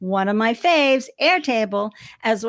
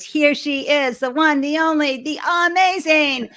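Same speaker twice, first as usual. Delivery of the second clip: building announcer hype, rising higher and louder to the name; hype guest introduction; 6 semitones higher, 6 dB louder than usual. Real, not acted. Host bringing her guest on stage, in a building announcer hype. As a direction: stretch out the amazing Jenny like a ringside announcer.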